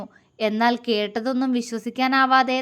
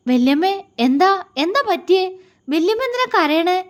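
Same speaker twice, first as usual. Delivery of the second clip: higher-pitched, worried questions; anxious concern; 7 semitones higher than usual. A narrator's reading. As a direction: ask urgently what is wrong, pitch lifted. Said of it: "ആകുലതയോടെ അവരോട് ചോദിച്ചു". (ആകുലതയോടെ ചോദിക്കുന്നു)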